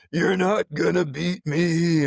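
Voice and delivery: high, loud, slow yell of defiance; retold yell. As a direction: yell it at the machine like a fight. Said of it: "he's yelling at the treadmill".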